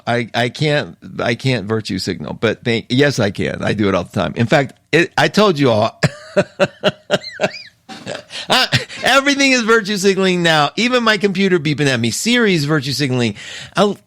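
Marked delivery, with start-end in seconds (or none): 6.3-7.6 a squeal of laughter, high up. Real, not acted. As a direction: burst into a high giggle. Laughing at virtue signaling joke.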